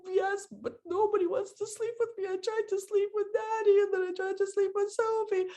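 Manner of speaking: put-on high, put-upon little girl's whine; whining child. Mother imitating her daughter insisting nobody will sleep with her, high whine. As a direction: keep complaining like a wronged child. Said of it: "really good at this drama".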